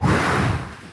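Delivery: long voiceless breath out; dying exhale. Acted. Podcaster ending the old man's confession, long dying exhale. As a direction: exhale as if crumbling into dust. The character crumbles into dust.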